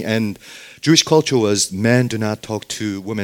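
ordinary talk

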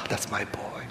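sighed, whispered fond delight; doting grandpa. Retold or imitated. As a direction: melt into a hushed, adoring whisper. Doting grandpa's reaction, acted out.

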